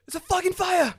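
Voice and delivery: high, loud warning shout; retold yell. Warning neighbours upstairs of the fire, high loud shout. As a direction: yell it up the stairwell. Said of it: "you know I'm loud".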